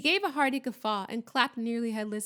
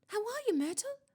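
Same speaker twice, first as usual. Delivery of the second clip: high, over-bright greeting; falsely bright; 12 semitones higher than usual. A narrator's reading. Student greets the gloomy ghost, falsely bright. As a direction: fake cheer, pitched way up. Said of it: "in a falsely bright voice".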